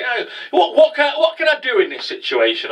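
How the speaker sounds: high-pitched, stammering excuse; helpless protest, imitated. Acting character's helpless reply.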